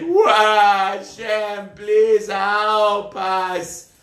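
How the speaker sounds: very slow, drawn-out plea; mock pleading wail. Attacked people beg Hashem for help, mock wail. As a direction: wail for help, stretching every word.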